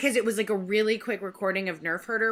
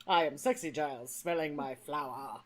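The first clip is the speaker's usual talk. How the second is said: sexy Giles voice; 6 semitones lower than usual. Retold or imitated. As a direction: play a smug seductive man. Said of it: "It's like".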